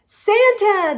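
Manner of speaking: high, loud delighted cry; excited shout. A narrator's reading. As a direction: burst out with joy at the sight. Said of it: "the snowman shouted excitedly".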